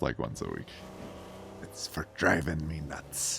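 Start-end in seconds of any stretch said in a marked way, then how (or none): 1.6-3.4 quiet, slow pirate pun; half-hearted pirate voice, softly; mock pirate punchline. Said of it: "I say that joke at least".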